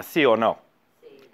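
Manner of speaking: ordinary delivery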